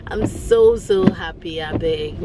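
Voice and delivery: normal talking voice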